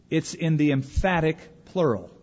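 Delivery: normal talking voice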